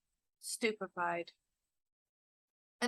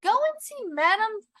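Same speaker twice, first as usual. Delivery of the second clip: high, louder urging; exclaiming; 10 semitones higher, 5 dB louder than usual. A narrator's reading. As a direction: exclaim it as an insistent husband. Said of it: "exclaimed her husband".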